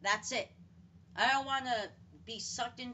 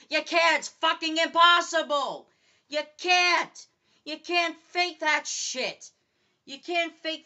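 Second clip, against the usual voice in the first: angry outburst; 3 semitones higher, 9 dB louder than usual. Real, not acted. Ranting at conspiracy believers.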